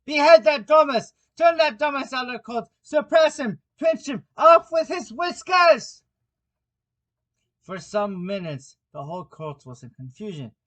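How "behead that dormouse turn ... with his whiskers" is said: high, loud screaming orders; frantic shriek. A narrator's reading. King orders the dormouse thrown out, shrieking. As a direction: scream each order at the court. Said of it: "the king shrieked out".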